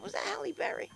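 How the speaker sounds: high, soft whisper; whispered question. Retold or imitated. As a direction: lean in and ask softly.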